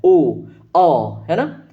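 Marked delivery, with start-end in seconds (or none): 0.0-0.3 ओ pushed loud from the throat, lips rounded; o sound demo. Shown as a demonstration. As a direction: stress ओ from the throat. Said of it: "कंठ पे जोर पड़ रहा है". (ओ को कंठ पर जोर देकर)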